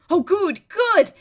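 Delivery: loud, rising joyful cheer; excited cheer. Acted. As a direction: cheer the good news. Gate opens, hermit rejoices.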